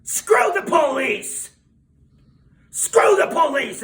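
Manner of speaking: very loud, high, drawn-out shout; full-volume, high party yell; drunken yell. Retold or imitated. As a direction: bellow it like a drunk rallying a crowd. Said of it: "I yelled".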